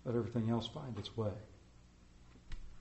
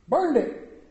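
much higher and louder, burst out; outraged exclamation; 12 semitones higher, 9 dB louder than usual. Acted. Preacher repeating that the Klan burned the farm, outraged cry. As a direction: fling the words out in outrage.

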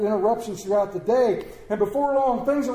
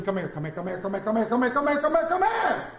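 rapid-fire repeats climbing much higher; tobacco auctioneer; 5 semitones higher than usual. Acted. Parent calling the children, rapid auctioneer patter. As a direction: rattle it off, rising to a peak. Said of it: "you sound like a tobacco auctioneer".

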